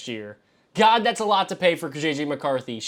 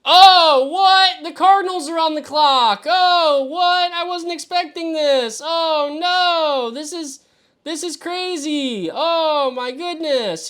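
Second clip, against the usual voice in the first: shocked; 11 semitones higher, 7 dB louder, slower than usual. Real, not acted. Shocked by surprise draft trade.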